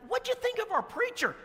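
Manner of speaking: high, sugary church-greeter welcome; gushing welcome. Retold or imitated. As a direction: lay the welcome on thick and high. Gushing question to visitor.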